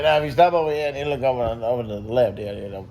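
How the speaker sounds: quick Cajun twang, lightly done. Retold or imitated. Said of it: "that twang".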